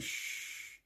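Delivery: long, hushed "shh"; soft hissed hush; imitated shush. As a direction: shush softly to quieten someone. Hushing in the retold scene.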